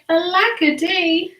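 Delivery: high-pitched mock wail; theatrical lament. A narrator's reading. Drip laments his family leaving him, theatrically plaintive. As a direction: bemoan it in a high storybook cry. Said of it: "And he cried".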